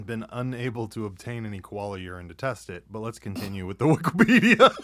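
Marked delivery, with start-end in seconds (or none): 3.8-4.7 bursting out laughing mid-line. Real, not acted. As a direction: laugh through the words. Cracking up mid-sentence.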